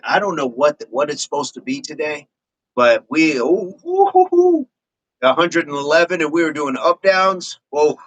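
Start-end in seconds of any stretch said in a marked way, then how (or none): 3.4-4.7 high rising "ooh" whoops; falsetto-high whooping. Real, not acted. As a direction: let out a string of high-pitched oohs. Whoops while recalling the workout.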